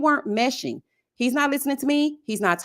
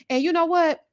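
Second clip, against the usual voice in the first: ordinary delivery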